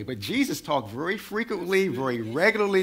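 ordinary delivery

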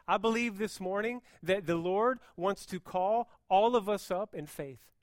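normal talking voice

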